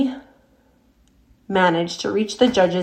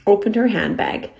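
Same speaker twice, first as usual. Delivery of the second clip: ordinary delivery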